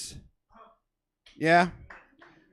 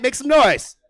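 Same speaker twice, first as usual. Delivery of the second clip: loud, higher call to the crowd; loud hype call; 7 semitones higher, 6 dB louder, slower than usual. Real, not acted. Host calling for noise, the first ask. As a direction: ask for noise, big and bright. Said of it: "make it a little louder".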